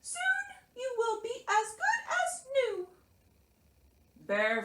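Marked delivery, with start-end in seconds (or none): 0.0-2.9 very high-pitched little mouse; cheerful Mouse. A narrator's reading. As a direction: reassure brightly in a tiny mouse voice. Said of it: "much too cheerful".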